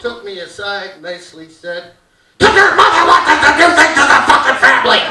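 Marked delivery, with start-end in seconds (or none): 2.4-5.0 loud, fast furious dad rant; hammed-up dad bellowing at his kid; an exasperated father shouting the family holiday rules; dad yelling.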